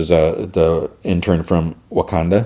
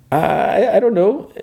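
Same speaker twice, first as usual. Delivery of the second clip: high sheepish dodge; slower than usual. Retold or imitated. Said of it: "he's like".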